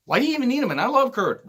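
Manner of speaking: high-pitched, baffled protest; exasperated. Real, not acted. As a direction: plead the point with pitch jumping way up. Exasperated questioning of the booking.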